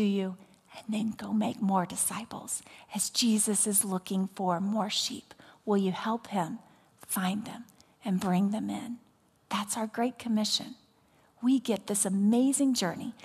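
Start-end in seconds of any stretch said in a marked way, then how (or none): 0.0-10.7 hushed, intimate pastoral whisper; quiet pleading whisper to the flock; soft whisper. Real, not acted. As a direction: whisper the call like a secret shared. Intimate altar call questions.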